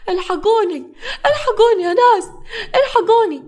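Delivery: high, loud cry of alarm; screaming for help. A narrator's reading. Nawara standing on the bed beside Hamza, screaming for help. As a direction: shriek out to rouse everyone. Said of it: "وهي بتصرخ".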